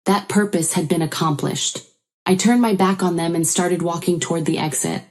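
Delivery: ordinary delivery, nothing marked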